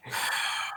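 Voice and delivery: long, breathy exasperated exhale; exasperated sigh. Acted. Colonel's breath heard by the party, exasperated sigh. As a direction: heave a voiceless, weary sigh. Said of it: "you hear an exacerbated breath".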